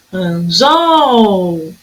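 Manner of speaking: "Anzol" with a very long "zol"; slow "Anzol", lingering on the final syllable; stretched last syllable. Shown as a demonstration. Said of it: "a sílaba tônica no final".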